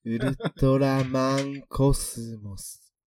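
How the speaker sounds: very slow, drawn-out syllables; slow motion. Shown as a demonstration. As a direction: stretch each syllable slowly. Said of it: "ゆっくり言ってみるやつね".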